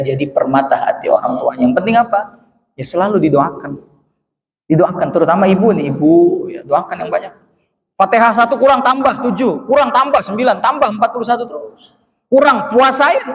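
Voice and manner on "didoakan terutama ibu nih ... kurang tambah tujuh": normal talking voice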